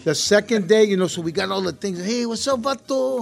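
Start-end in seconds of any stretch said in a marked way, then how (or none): 2.0-3.2 high, loose Chicano street greeting. Retold or imitated. Actor showing the East LA slang he picked up, Chicano street greeting. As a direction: toss it out like a homeboy from East LA. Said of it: "we start to get the colloquialisms down".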